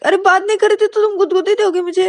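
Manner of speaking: high, loud indignant question; mock outrage. Payal complaining to Ananya about tickling, indignant. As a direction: protest in disbelief.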